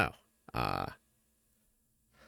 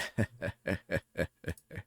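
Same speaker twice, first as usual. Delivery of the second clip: villain's gloating laugh; evil cackle; 4 dB quieter than usual. Acted. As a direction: cackle like a schemer. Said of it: "Cackles evilly".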